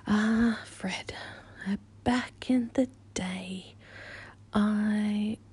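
ordinary talk

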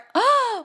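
high, sharp fake gasp of surprise; mock shocked gasp. Mock surprise at obvious advice.

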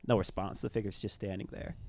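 usual speaking voice

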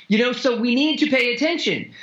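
usual speaking voice

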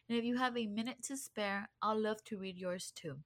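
soft, even sign-off read to listeners; podcast outro read. Acted. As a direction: wrap up the episode like a host's closing script.

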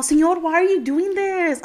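high-pitched exasperated pleading; acted-out frustrated complaint; retold frustration. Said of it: "be all frustrated".

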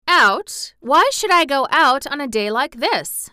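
put-on spoiled girl refusing to go out; Mary protesting. A narrator's reading. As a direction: scorn the wintry day.

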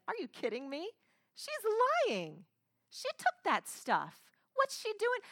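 high-pitched, put-on disbelief; hammed-up scoffing indignation; mock incredulous denial. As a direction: protest as if falsely accused. Imagined denial by the accused man.

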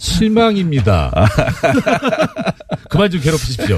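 high, laughing mock complaint; mock disappointment. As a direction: pretend to be let down, laughing. Host jokingly feigns disappointment.

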